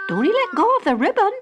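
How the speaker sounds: high, urgent warning voice, quick; mother's warning. Acted. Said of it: "said her mother".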